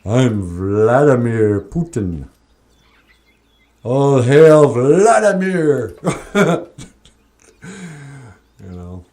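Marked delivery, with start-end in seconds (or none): 0.0-6.0 slow, louder cartoon villain announcement. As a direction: proclaim it like a movie villain. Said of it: "sounds like a good villain".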